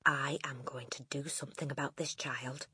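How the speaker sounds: hushed, near-whispered resolve; telling herself. A narrator's reading. Teacher vowing to herself to help pupil, hushed. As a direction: murmur it privately with determination. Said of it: "she told herself".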